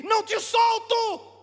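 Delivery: slow, high, full-volume refusal; defiant shout. Acted. Jacob answering God, defiant full shout. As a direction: stretch out each word at full cry.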